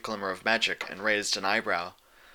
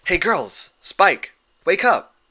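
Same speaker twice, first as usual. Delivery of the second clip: loud and higher, waking the room; calling out; 8 semitones higher, 7 dB louder, much slower than usual. A narrator's reading. Rainbow Dash calls her sleeping friends awake, loud. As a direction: shout them awake, pitch up. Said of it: "Dash called".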